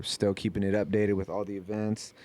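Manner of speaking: normal talking voice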